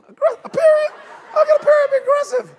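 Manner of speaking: very high, loud mock disbelief; incredulous outburst. Preacher replying to texters about periods, high loud incredulity. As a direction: squawk it in comic outrage.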